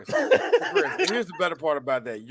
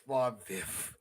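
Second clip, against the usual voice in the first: much lower and softer, pleading; mock plea; 10 semitones lower, 12 dB quieter than usual. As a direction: plead under your breath. Voicing McFly pleading.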